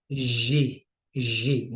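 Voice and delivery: ये, the French G sound. Shown as a demonstration. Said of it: "हम उसको French में बोलते हैं". (फ्रेंच G का उच्चारण)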